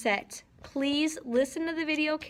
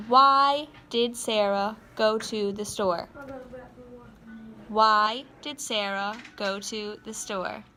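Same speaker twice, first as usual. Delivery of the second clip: slow dictation; slower than usual. Shown as a demonstration. Teacher dictating sentence to write.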